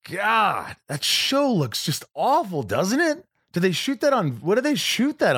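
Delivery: high, mocking parent voice; parents mocking the show. Parents judging my TV show, in a high mocking voice. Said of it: "They would say".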